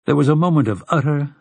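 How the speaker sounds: ordinary delivery, nothing marked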